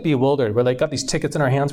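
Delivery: ordinary talk, nothing marked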